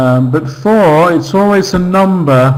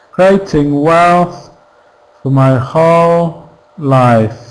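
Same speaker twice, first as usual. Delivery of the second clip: slow, with weight on "Creating", "wealth", "whole", "life"; stressed key words; slower than usual. Shown as a demonstration. Teacher marking the key words for students, slow stressed content words. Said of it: "these are the stressed syllables".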